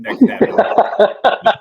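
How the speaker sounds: slow, breathy words through laughter; whispered laugh. Real, not acted. Host joking with cohost about tripping over the chair, whispered laughing. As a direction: laugh it out under your breath.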